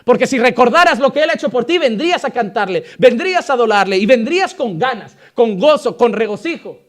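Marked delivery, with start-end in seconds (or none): none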